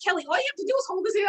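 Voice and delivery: high, rapid mock coaxing; retold coaxing. Jerry trying to get her dating, retold high coaxing. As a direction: imitate a friend pushing, fast and high.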